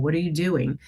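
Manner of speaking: normal talking voice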